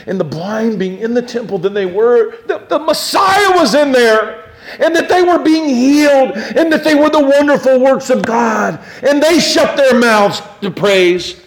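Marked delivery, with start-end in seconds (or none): 2.5-11.4 high, loud preacher's fervor; fervent preaching. Acted. Preacher laments the priests to the congregation, impassioned. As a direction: press the point home with passion.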